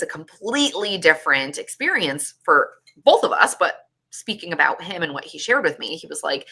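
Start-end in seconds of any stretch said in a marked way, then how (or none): none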